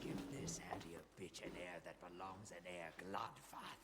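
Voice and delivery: low, quiet menacing raider; unhinged villain. Acted. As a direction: hiss it like a cruel raider. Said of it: "That's the evil voice again".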